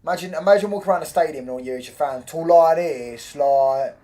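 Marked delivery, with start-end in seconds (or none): 2.3-4.0 loud, hammed-up Birmingham accent; badly faked Brummie, drawn out; terrible Brum accent. Shown as a demonstration. Mocking rival fans in the stadium, terrible Brum accent. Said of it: "That's a terrible Brum accent".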